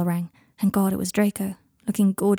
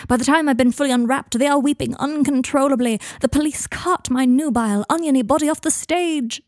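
raised, higher-pitched dramatic narration; theatrical monologue; 5 semitones higher, 4 dB louder than usual. Acted. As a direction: build to a hammed-up climax. Draco performs a mock onion burlesque.

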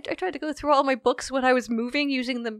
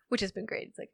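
ordinary talk, nothing marked

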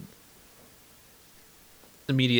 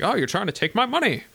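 high-pitched hammed-up accusation; put-on crazy-woman voice, pitched high; mock paranoid accuser; 10 semitones higher, 3 dB louder than usual. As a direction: play her as comically unhinged and suspicious. Acting out the crazy sister's accusation.

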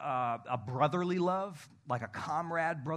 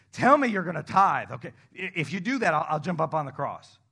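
mock bargaining Jesus; 7 dB louder than usual.

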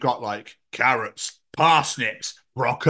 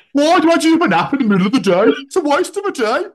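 high, loud, exaggerated Brummie; mock Brummie accent; 12 semitones higher, 7 dB louder than usual. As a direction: mimic a friend in hammed-up Brummie. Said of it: "That was the brummiest thing".

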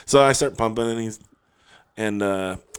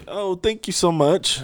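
high, slow thanks from a stranger; imitated Italian stranger; 9 semitones higher, 3 dB quieter, slower than usual. Stranger thanking him for directions, high Italian imitation. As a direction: lightly imitate a grateful Italian. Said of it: "he's got an Italian accent".

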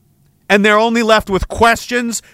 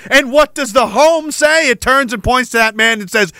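ordinary talk, nothing marked

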